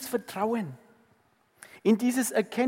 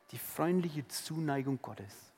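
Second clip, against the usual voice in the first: much softer, lower repeat of "freundliche"; soft tender echo; 5 semitones lower, 8 dB quieter than usual. Shown as a demonstration. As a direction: say it again as a hushed echo. (leises, zärtliches Echo)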